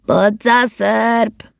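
high, very drawn-out call; blaring shout. A narrator's reading. Elf shouting drink ideas from the cart, long high holler. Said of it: "és egyre harsogott".

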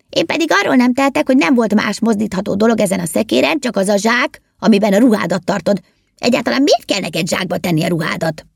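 high, argumentative elf voice; indignant retort. A narrator's reading. Tramini defending himself to the driver, indignant and high.

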